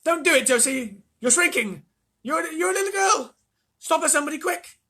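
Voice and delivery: high, loud frantic shout; husband shouting at shrinking wife. A narrator's reading. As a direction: shout for help in panic. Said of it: "shouted Grandpa Joe".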